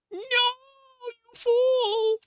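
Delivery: very high, very slow hammed-up cry; long shrill wail of "no, you fool"; mock-dramatic wail. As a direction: stretch out the despair theatrically. Third death, drawn-out cry.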